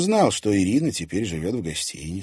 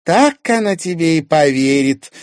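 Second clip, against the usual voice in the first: high, loud mocking disbelief; pitched-up derisive retort; scornful chuckle; 10 semitones higher, 11 dB louder than usual. A narrator's reading. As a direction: jeer as if about to laugh. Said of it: "хохотнула Ирина".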